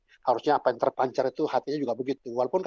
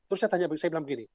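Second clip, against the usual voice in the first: ordinary delivery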